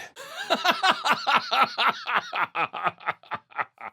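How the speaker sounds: a sudden, high, wild burst of laughter. Acted. Laugh burst in game lines.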